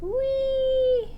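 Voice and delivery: high squealing whee; a delighted high-pitched whee; playful whee. Real, not acted. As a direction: squeal it out with glee. Playful squeal while brushing.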